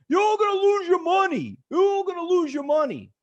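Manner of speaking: high, loud mocking shout of an angry heckler. Retelling a heckler at his presentation, loud angry shout. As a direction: yell it like the furious guy in the crowd. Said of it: "who's so angry".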